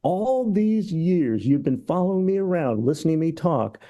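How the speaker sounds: high, indignant rant building up; comic tirade. Retold or imitated. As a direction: rant at followers who failed him. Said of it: "he goes into this tirade".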